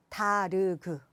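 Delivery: 다르그 as three separate syllables; foreigner's syllable split. Shown as a demonstration. Foreigner reading Korean by letters, 다르그 in three syllables. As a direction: sound every consonant of 다르그 with its own vowel. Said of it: "외국인들은 이렇게 발음할 수도 있어요".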